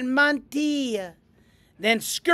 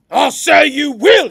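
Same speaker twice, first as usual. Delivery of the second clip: loud, high, boorish pig; snorting pig; 5 semitones higher, 10 dB louder than usual. A narrator's reading. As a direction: snort it out loudly. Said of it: "he snorted".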